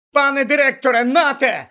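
high, loud yell. Acted. Harry yelling to Dumbledore as he splashes water. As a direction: bellow it at him. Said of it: "загорлав він".

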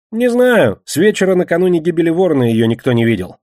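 high, loud, exasperated character voice; defensive Кегля. Acted. Кегля is questioned about when she vanished, defensive. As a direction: protest ignorance, pitched well up.

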